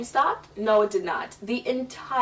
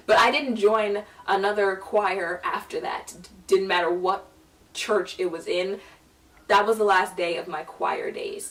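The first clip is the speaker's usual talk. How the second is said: usual speaking voice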